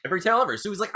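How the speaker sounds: ordinary talk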